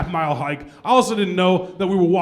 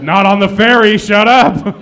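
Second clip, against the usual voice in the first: louder, annoyed snap; snapping back; 3 semitones higher, 8 dB louder, slower than usual. Retold or imitated. Comic snapping at a teasing buddy, louder. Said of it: "And I said".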